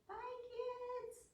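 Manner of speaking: squeaky, soft puppet voice; high puppet goodbye. Acted. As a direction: wave goodbye as a tiny puppet. Puppet officer says goodbye.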